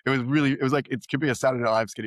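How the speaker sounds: usual speaking voice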